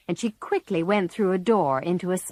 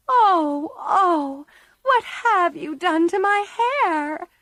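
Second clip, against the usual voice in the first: sobbing cry, high and louder; 11 semitones higher, 4 dB louder, slower than usual. Acted. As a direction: wail over burnt hair. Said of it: "cried Meg".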